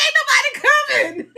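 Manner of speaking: high, loud-edged laughing protest; laughing complaint. Retold or imitated. Speaker complains to God that nobody comes, laughing protest. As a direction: whine it out high while cracking up.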